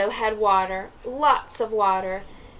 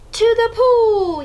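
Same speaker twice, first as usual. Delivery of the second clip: higher, louder, slow shout; yelled; 12 semitones higher, 7 dB louder than usual. A narrator's reading. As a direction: shout it to the crowd. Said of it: "yelled the firemen".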